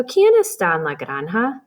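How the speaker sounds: "¿Quién está en la granja?" read with a rising question tune. Shown as a demonstration. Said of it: "is how you would read that".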